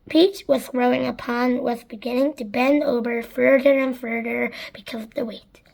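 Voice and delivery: ordinary delivery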